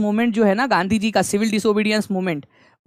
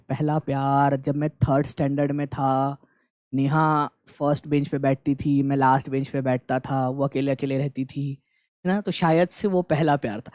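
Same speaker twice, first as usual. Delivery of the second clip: musing aloud; 7 semitones lower, 5 dB quieter than usual. Acted. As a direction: drift softly into a private memory, voice dropped. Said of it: "तो आप लोग सोचोगे". (धीमी, दबी आवाज़ में यादों में खोया हुआ)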